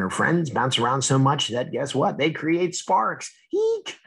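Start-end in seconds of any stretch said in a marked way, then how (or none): 3.5-4.0 very high squeak of surprise; a tiny cartoon shriek; startled eek. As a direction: raindrop startled by electric sparks. Drop startled by sparks.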